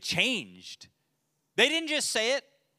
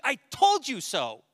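higher, louder, slow gloating; smug told you so; 9 semitones higher, 7 dB louder, slower than usual. Retold or imitated. Jonah gloating after they repent, loud and slow. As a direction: stretch out told with triumph. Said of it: "he's like".

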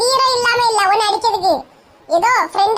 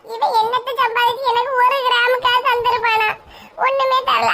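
ordinary delivery

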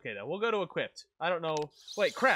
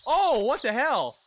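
loud, high, drawn-out surprise; real shocked yell; startled outburst; 8 semitones higher, 12 dB louder, slower than usual. Real, not acted. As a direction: exclaim slowly and loudly in disbelief. Startled by event in game.

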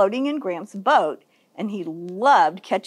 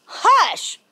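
yelled hush; 9 semitones higher than usual. A narrator's reading. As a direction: yell for silence in a storybook voice. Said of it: "The catfish yelled".

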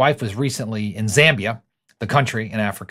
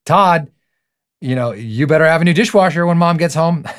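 put-on a higher, louder kids' voice teasing their dad; mock kids teasing; 6 semitones higher, 6 dB louder than usual. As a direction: tease him like his cheeky kids, pitched up and loud.

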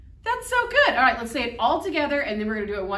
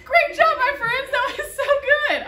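excited praise; 5 dB louder than usual. Real, not acted. Praising kids after silly verse.

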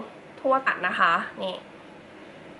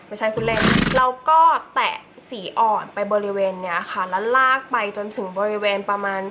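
ordinary talk, nothing marked

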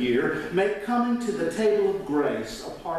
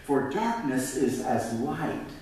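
raised, preached scripture reading; 5 semitones higher than usual. Acted. Pastor reading the Psalm aloud to his congregation, preaching it. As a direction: read the psalm out as if proclaiming it to the church.

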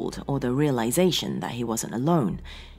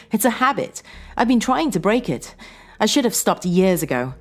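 high, louder voice of a regretful wife; Sarah, estranged wife, regretful; 6 semitones higher, 4 dB louder than usual. Acted. Sarah explaining her old habit to John, regretful. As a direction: blurt regret in a raised pitch.

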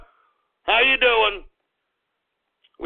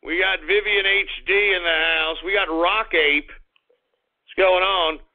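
ordinary talk, nothing marked

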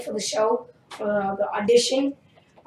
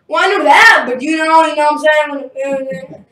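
loud, higher flustered dad impression; mock defensive; 4 semitones higher, 9 dB louder than usual. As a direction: protest loudly as the caught-out dad. Imitates dad's defensive comeback.

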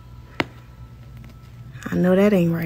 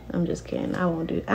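whispered aside; 3 semitones lower, 11 dB quieter, faster than usual. Real, not acted. Takes back review threat.

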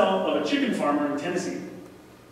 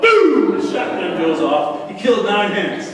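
shouted story punchline; 3 semitones higher, 9 dB louder, slower than usual. Retold or imitated. Pastor shouting the shotgun blast of the farmer's story to the congregation.

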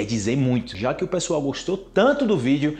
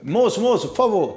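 flag down a waiter across the room; calling the waiter; 5 semitones higher than usual. Retold or imitated. He calls the waiter in a restaurant, raised calling voice. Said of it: "se eu vou chamar o garçom, eu falo".

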